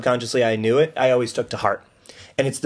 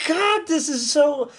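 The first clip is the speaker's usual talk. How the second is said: very high, strained disbelief; real high-pitched amazement; astonished squeal; much slower than usual. Real, not acted. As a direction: squeal as if stunned by a revelation. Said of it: "Why are you talking like this?".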